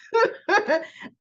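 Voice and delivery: a high, helpless burst of laughter; high cackling laugh. Real, not acted. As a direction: crack up at the absurdity. Laughing at the scene.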